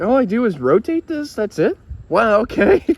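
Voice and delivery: delighted at how simple it is; impressed surprise. Retold or imitated. Friend discovering the single-dial control, high impressed surprise.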